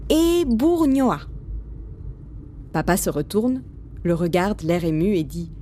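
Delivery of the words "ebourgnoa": high, slow sounding-out; child reading aloud. A narrator's reading. Boy reading the village sign, slow reading aloud. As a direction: read a sign out syllable by syllable, pitched up. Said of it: "lit la pancarte à voix haute".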